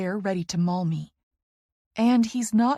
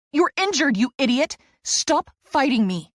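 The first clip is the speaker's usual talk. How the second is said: higher, louder furious shout; angry yell; 6 semitones higher, 6 dB louder than usual. A narrator's reading. Therapist wrestling the player, shouting angrily. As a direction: yell at him to stop. Struggling with him.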